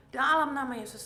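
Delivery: loud, emphatic invocation; commanding prayer. Retold or imitated. Patient clutching the blood bag in prayer, retold forceful invocation. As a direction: invoke the name with force.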